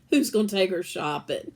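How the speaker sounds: plain reading voice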